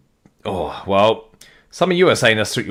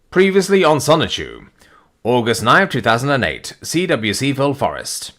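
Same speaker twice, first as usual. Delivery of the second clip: higher, punchy recap announcer; 4 semitones higher than usual. Acted. Recap intro of the comic.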